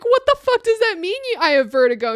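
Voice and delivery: high, loud mock outrage; shocked disbelief. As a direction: throw the question up in disbelief. Retold disbelief at diagnosis.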